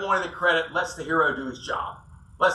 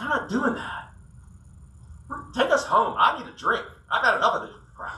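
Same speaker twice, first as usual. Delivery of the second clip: higher, emphatic refusal; fed up; 5 semitones higher than usual. Retold or imitated. Worn-out client telling the guide he's quitting for the day. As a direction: done with it, take me home. Speaker refuses to keep fishing.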